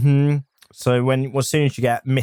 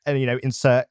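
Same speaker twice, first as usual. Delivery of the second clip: ordinary delivery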